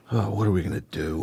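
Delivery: soft, quick, resigned sigh of a question; weary inner voice. Retold or imitated. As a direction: ask it under your breath, fed up. Her daily inner voice.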